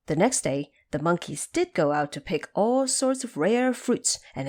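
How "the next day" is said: plain reading voice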